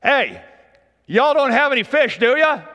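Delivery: Jesus hollering to the fishermen, loud; calling across the water. Retold or imitated. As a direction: shout it out to the boat a hundred yards off. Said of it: "but check out this line".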